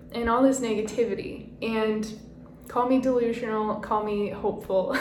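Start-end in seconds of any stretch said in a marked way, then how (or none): none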